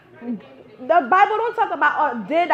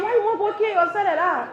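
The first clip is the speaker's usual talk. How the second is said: ordinary talk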